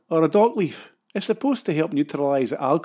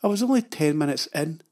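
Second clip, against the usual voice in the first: ordinary delivery, nothing marked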